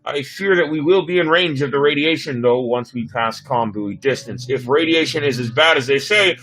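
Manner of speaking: a hint of a calm science officer; lightly voiced officer briefing. Officer briefing the captain on the route, calm. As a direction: explain it matter-of-factly.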